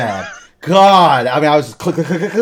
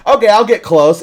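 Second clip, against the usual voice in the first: much higher, louder falsely agreeable voice; mock friend; 10 semitones higher, 11 dB louder than usual. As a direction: promise it with fake innocence. Imitating J J's false promise.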